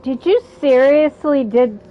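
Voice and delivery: ordinary talk, nothing marked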